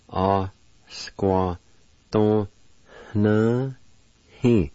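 "A squa ton na hi" with each syllable drawn out and apart; syllable-by-syllable demo. Shown as a demonstration. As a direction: sound out "A squa ton na hi" slowly. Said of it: "And it's pronounced like this".

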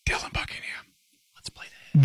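conspiratorial acted whisper; hushed, voiceless mock suggestion. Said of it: "somebody said in a whisper".

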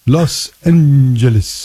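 slow, stretched mock pronouncer voice; mimicking strange-accented pronouncer. Host mocks the online pronunciation guy, slow and stretched. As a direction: draw out Los Angeles like an instructional recording. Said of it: "the guy with the strange accent".

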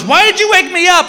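high-pitched mock outrage; indignant complaint. Wife woken from sound sleep challenges husband, high and indignant. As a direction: demand an answer, voice way up.

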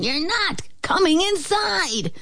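high-pitched piglet defiance; little pigs. A narrator's reading. Pigs bar the wolf from coming in, high piglet voice. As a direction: refuse in a high pig voice. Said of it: "The pigs grunted".

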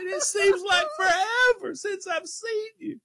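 high, teary laugh-cry on the line; mock-emotional, breaking up with laughter; half-crying with laughter; laughing through it. Retold reunion with laughter.

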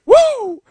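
high, excited "woo!"; celebratory whoop. Retold or imitated. Host imitating soccer player scoring at foosball, high celebratory whoop. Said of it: "she scored and went".

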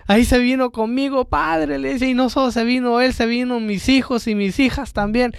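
a hint of an eager woman telling the priest her news; the lady's voice. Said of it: "le dice la, la señora".

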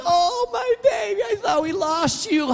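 wife hugging the found dog in tearful joy; overjoyed wife. Retold or imitated. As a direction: gush over the dog like a long-lost sister. Wife reunited with lost dog.

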